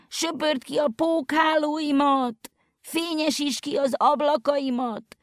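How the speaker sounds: high pleading calls for help; high voice calling out for help from far inside; crying voice from the house. A narrator's reading. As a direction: beg from inside the old house.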